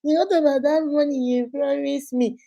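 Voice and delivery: put-on high, tiny baby voice; mock baby whine, high and small; babyish side-chick voice. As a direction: beg like a two-month-old baby. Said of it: "they be doing like snake".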